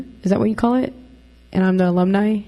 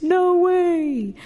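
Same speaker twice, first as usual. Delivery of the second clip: very high, drawn-out mock disbelief; imitating coach's disbelief; 8 semitones higher, much slower than usual. Runner imitates old coach hearing she is on campus, high mock disbelief. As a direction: squeal it high as an exaggerated coach reaction. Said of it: "He'd be like".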